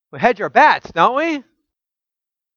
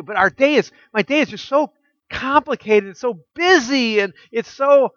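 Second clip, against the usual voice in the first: lightly acted complaint, higher-pitched; overwhelmed churchgoer; 6 semitones higher than usual. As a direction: pile up excuses in a higher, harried voice. Voicing a busy churchgoer's objection.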